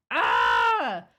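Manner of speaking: loud startled cry; a sudden yelp of alarm. Real, not acted. Streamer yelps as she remembers the salad photo, sudden cry.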